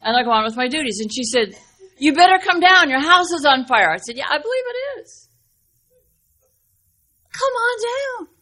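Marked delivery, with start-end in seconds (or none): 2.0-3.9 urgent warning. Retold or imitated. Neighbor warns of fire. 4.2-5.3 unbothered. Retold or imitated. Calm reply to fire warning. 7.3-8.3 urging. Retold or imitated. Neighbor urging her down.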